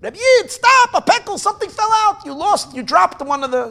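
high, loud call after someone; retold yelling. Man running after a carriage that dropped a bag, yelling. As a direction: imitate yelling after a passing coach, easing off toward the end. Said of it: "and he's yelling".

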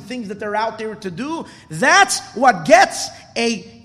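loud, high, slow sermon climax; impassioned preaching. Real, not acted. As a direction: drive each phrase home to the congregation, stretching the key words. Preaches the climax at full force.

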